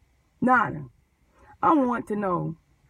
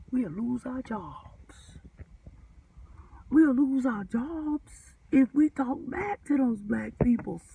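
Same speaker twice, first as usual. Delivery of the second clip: slow fearful plea; slower than usual. Retold or imitated. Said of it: "it's like the runaway slave said".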